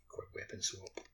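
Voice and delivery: usual speaking voice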